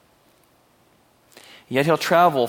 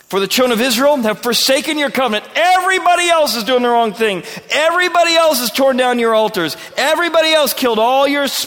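higher and louder, protesting; self-pitying complaint; 8 semitones higher, 5 dB louder than usual. Acted. Elijah complaining to God that he alone is left, loud and high. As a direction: rant that you alone stayed faithful.

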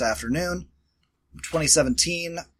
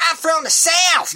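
slow, high, loud mock Southern drawl, hammed-up; mock Southern accent; 12 semitones higher, 5 dB louder than usual. Mocking actors who fake Southern accents, hammed-up Southern drawl. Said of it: "fake doing one or trying to do one".

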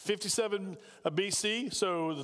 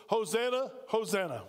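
ordinary delivery

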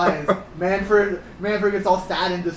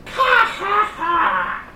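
high shrieking bird caw; crow bird cry; 3 dB louder, much slower than usual. Retold or imitated. Mimicking the Andy bird's cry, high shrieking caw. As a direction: screech it like a gross crow. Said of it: "that's the sound this bird makes".

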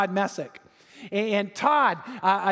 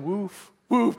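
tag. Retold or imitated. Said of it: "walked around all day going".

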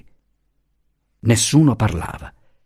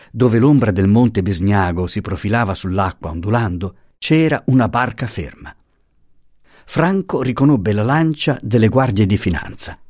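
ordinary delivery, nothing marked

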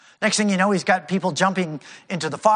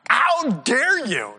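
higher, slow, drawn-out "How dare you?"; the ten disciples' indignant "How dare you?", higher; 5 semitones higher, much slower than usual. Retold or imitated. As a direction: lift and stretch "How dare you?" in mock outrage. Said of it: "they are indignant".

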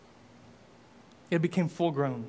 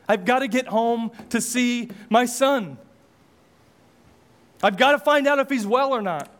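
urgent, rising and louder toward let's go; frantic urging; 4 semitones higher, 5 dB louder than usual. Acted. Father urging his cab driver home, frantic and louder. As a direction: push the driver, desperate to get home.

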